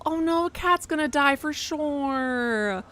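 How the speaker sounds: high, slow mock wail; mock lament. Player lamenting that the cat will die, slow mock wail. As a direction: draw it out in mock dismay.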